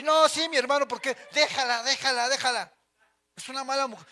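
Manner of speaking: más alto y fuerte, consejo metiche; pasajeros metiches. Retold or imitated. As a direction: mete tu opinión como pasajero entrometido. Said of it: "Todos se meten en sus pláticas". (pasajero metiche aconsejando fuerte)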